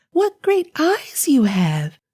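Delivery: put-on high and slow, a child amazed; drawn-out girlish wonder; wondering little girl. Girl questions grandmother.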